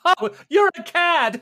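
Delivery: high, loud, slow mock outrage; scandalised exclamation. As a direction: gasp it like a scandalised lady. GM voices scandalised character.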